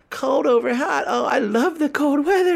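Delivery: high whiny mock voice of cold lovers. Host mocking people who like cold: high, whiny mockery. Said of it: "you hear at least".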